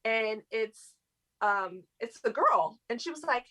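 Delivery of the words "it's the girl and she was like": normal talking voice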